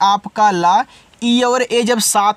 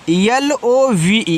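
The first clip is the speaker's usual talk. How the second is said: slow, careful spelling of पी एल ई ए एस ई; letters spelled out one by one, slowly; much slower than usual. Shown as a demonstration. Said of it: "spelling letters".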